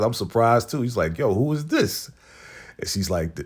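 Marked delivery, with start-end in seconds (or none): 1.2-2.1 mock surprise. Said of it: "[name] is mad surprised".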